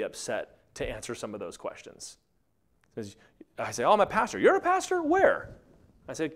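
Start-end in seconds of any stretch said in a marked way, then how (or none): 3.8-5.5 high, loud mock disbelief; acted incredulous exchange. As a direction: pitch the doubting questions way up. Acted sceptical exchange with pastor.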